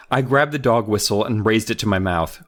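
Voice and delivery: ordinary delivery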